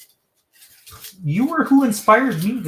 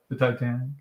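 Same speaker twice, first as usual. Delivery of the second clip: ordinary delivery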